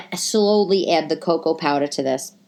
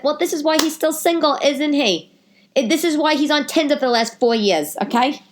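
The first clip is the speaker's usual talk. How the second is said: sassy retort; 8 semitones higher than usual. Retold or imitated. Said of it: "I said".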